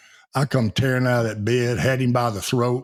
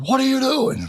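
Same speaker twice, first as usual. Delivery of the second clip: hollered. Retold or imitated. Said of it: "he hollered".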